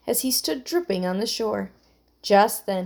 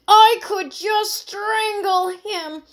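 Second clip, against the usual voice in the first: put-on high, loud, exasperated girl; through clenched teeth; 13 semitones higher, 7 dB louder than usual. A narrator's reading. Emily to Heidi in the leaking boat, exasperated. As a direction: seethe and wail at a high pitch. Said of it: "said Emily through clenched teeth".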